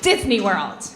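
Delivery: ordinary talk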